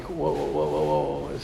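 very low, soft run of whoas; retold halting whoa. Teacher recalls the thought that stopped his rant, low murmured halt. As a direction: murmur a low string of whoas, reining yourself in.

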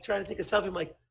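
ordinary delivery

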